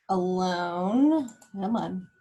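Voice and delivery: ordinary talk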